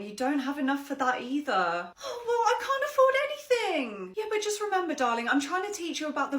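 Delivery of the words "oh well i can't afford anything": put-on high, crying little girl; child whining. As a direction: wail like a kid denied a toy. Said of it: "ten-year-old".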